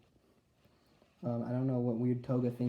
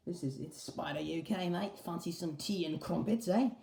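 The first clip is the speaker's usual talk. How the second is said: higher, hammed-up British accent; 6 semitones higher than usual. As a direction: play a cheeky Brit with a British accent. Voicing Spider UK figure.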